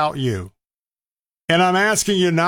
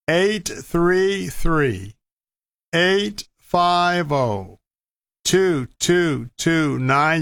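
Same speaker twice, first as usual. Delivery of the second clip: very slow, drawn-out digits; slow and deliberate; much slower than usual. Real, not acted. Radio host giving listeners the donation phone number, slowly. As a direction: read the number slowly, digit group by digit group, pausing between. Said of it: "I'll say it slowly".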